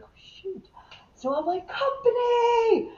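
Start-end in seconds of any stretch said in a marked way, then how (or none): 0.0-0.7 whispered to herself in panic; panicked whisper. Retold or imitated. Recruit about to command the company, panicked whisper. 1.7-2.9 slow, high, drawn-out command shout; commanding call. Retold or imitated. New class leader calling the company, long loud command. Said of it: "I say it loud and I'm so strong and proud".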